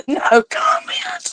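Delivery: breathy high whisper, slow. Pretend. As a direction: whisper it slowly and secretively. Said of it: "He said that in real bold letters".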